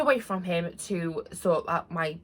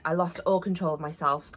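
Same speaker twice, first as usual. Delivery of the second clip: ordinary delivery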